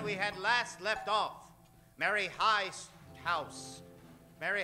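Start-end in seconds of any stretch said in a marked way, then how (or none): none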